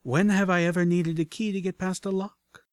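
high, playful boast as a question; cocky rhetorical question with a smile in it; smiling confidence. A narrator's reading. Lockpicker answers worried companion's objection, smiling confidently. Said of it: "With a smile".

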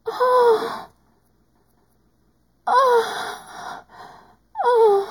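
high, loud moaning cries; loud climactic moans. Acted.

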